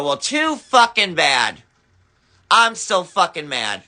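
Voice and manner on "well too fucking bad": ordinary talk, nothing marked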